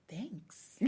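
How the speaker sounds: tiny whispered acknowledgment; hushed, minimal politeness; whispered reply. Retold or imitated. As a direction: barely whisper it. Retold reply to the hovering clerk.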